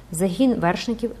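ordinary delivery

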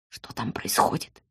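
breathy whisper, no voice at all. A narrator's reading. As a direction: whisper it so only he hears. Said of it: "прошептала я". (шёпотом)

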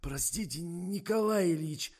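meek, slow, snuffly address; stuffed-up apology. A narrator's reading. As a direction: apologise through a head cold. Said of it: "явно сильно простуженный Миша".

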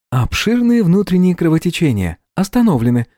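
high, even, methodical AI voice; methodical assistant. A narrator's reading. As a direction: list it like a diligent but dim assistant. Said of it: "с методичностью старательного, но туповатого ассистента".

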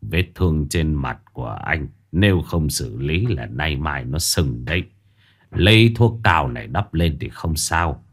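trầm và nhỏ, giọng ông già chậm rãi; hạ giọng xuống như cụ già điềm đạm; giọng ông lão. Acted. Ông cụ dặn Dần. (cụ già giới thiệu tên với Dần, giọng trầm chậm)

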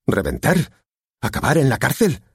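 heated father's challenge; angry father. A narrator's reading. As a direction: confront her with rising pitch. Father confronts daughter.

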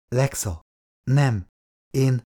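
halting, one word at a time; faltering start, unsure what to say; searching for words. A narrator's reading. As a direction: break it up with hesitant pauses. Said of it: "kezdte keresni a szavakat".

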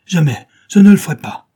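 loud, high outcry; acted vehement protest; cried refusal. A narrator's reading. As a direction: refuse with a horrified shout. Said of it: "Nick poussa un cri".

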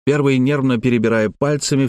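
usual speaking voice

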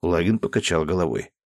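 usual speaking voice

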